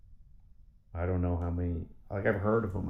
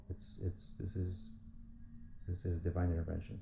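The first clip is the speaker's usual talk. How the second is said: hushed awe; 8 dB quieter than usual. Real, not acted. Awed hush.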